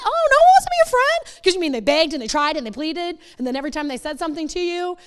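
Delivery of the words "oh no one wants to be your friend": very high, loud sarcastic squeal; mock them in disbelief; shrieking mock surprise; sarcastic mock shock. Mocking the pushy teen.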